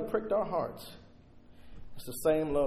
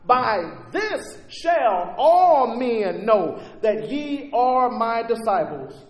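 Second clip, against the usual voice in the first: "By this shall all men know" very slow, loud, "all" stressed; slow emphatic reading; 7 semitones higher, 10 dB louder, much slower than usual. Shown as a demonstration. Pastor reading John to the church, slow and emphatic. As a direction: read the verse slowly with weight. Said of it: "emphatic by position".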